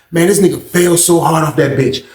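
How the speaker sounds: usual speaking voice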